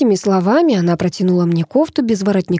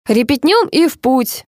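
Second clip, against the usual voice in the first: slow, higher, pleased call; 6 semitones higher than usual. A narrator's reading. Director glad to start the rehearsal, pleased. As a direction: draw it out, mildly delighted. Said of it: "обрадовался Павел".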